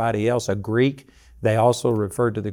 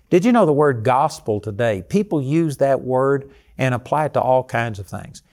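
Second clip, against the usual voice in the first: ordinary talk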